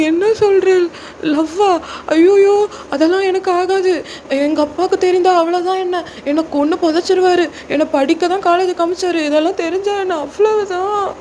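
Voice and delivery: put-on high-pitched, tearful junior girl in panic; acted wailing. Junior girl terrified of her father after senior's confession, acted tearful wail. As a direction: wail as if bawling in the street. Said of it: "அப்படின்னு நீங்க ஓன அழுவுறீங்க நடுரோட்டில்".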